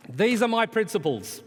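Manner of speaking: high, loud mock proclamation; mock-lofty loud announcement; declaiming Groucho Marx quote. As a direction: proclaim it grandly, set up the punchline. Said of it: "said famously".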